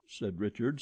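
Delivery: usual speaking voice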